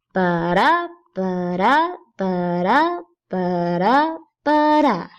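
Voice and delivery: Ba-da five times, each da stressed after a lighter ba; iambic rhythm demo. Shown as a demonstration. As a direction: beat out ba-da in even unstressed-stressed pairs, the last one falling. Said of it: "Each line will sound like this".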